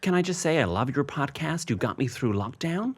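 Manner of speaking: lightly voiced American fan, thrilled; mild American accent. Fan thanking a podcast host in a mild American accent. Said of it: "Do the accent".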